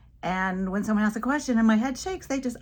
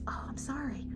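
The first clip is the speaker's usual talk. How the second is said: soft, higher sorry voice; sympathetic little boy; 6 semitones higher, 9 dB quieter than usual. Retold or imitated. Little boy apologizes to teacher, soft and sympathetic. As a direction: imitate a child's gentle sympathy.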